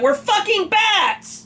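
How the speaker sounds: high-pitched, exasperated shouting rant; outraged rant. Real, not acted. Fan ranting to co-host about bats driving, outraged. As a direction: let indignation climb and stay up there.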